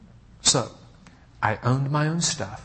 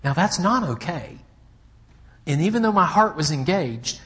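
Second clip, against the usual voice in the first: ordinary talk, nothing marked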